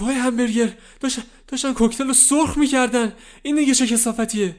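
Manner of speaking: high, loud distressed protest; upset outcry. Acted. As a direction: burst in shocked and upset. Kid bursts in upset.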